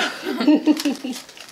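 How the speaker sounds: burst of laughter. Real, not acted. Laughs mid-sentence.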